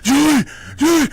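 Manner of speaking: high, loud, giddy calling out; excited yelling. Retold or imitated. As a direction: shout up the stairs like a thrilled kid. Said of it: "I was like".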